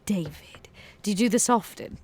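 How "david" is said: ordinary talk, nothing marked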